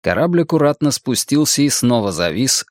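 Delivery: normal talking voice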